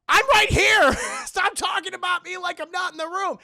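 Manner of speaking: high, very loud hammed-up screech; indignant yell. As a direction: yell like an ignored cartoon villainess. Ignored villainess yells at them.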